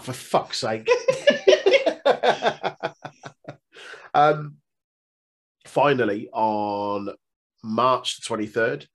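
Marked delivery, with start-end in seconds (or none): 0.9-3.5 squealing high-pitched laugh. Real, not acted. Muted mishap, big laugh.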